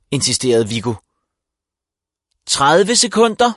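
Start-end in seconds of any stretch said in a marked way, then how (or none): 2.5-3.5 teacher calling out the countdown; shouting. A narrator's reading. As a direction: bark out the remaining seconds. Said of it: "råbte Ditte K".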